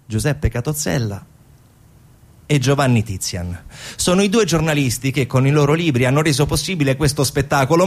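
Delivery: ordinary delivery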